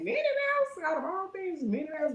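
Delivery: very high, stunned repeat; out of everything, really?; high-pitched bewildered echo; incredulous. Retold or imitated. Incredulous at the comparison.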